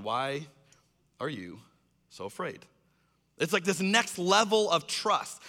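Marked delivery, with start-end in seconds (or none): 0.0-2.7 low, soft, spaced-out question; quiet question. Acted. Jesus answering the disciples' reasons, quietly. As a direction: pause between phrases, low and unhurried.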